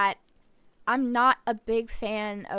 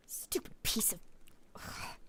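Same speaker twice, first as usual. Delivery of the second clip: frustrated whisper; 12 dB quieter than usual. Acted. Frustrated at item not fitting.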